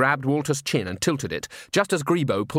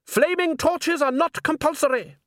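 high-pitched, impatient correction; exasperated. A narrator's reading. Salzella correcting a follower about torches, exasperated. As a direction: overrule them in a high exasperated cry.

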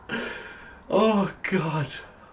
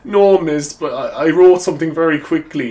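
higher, louder pleading schoolkid voice; excuse from a pupil; 6 semitones higher, 6 dB louder than usual. Retold or imitated. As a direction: play the caught-out pupil making excuses to the teacher. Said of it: "it's like in school when the teacher's like".

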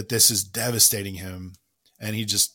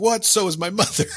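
higher voice, giggling through the line; can barely get the quote out for laughing; 9 semitones higher, 5 dB louder than usual. Retold or imitated. Retelling a favourite film joke to a co-host, laughing.

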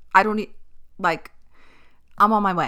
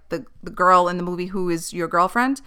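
ordinary delivery

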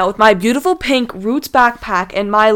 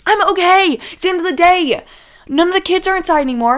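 higher, louder protest of her younger self; insistent reply; 7 semitones higher, 3 dB louder than usual. Retold or imitated. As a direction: insist it's the end of the day. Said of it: "And I was like".

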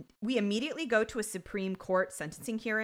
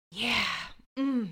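long exhale, then contented yeah and hum; cigarette-after-sex satisfaction. Retold or imitated. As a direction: imitate blowing out smoke, deeply satisfied. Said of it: "like he's smoking a cigarette after sex".

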